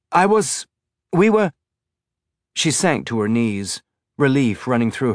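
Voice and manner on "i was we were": higher, louder, broken-off start; flustered. A narrator's reading. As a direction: falter as you try to get the words out. Elvie stammers, overwhelmed at finding Holden alive.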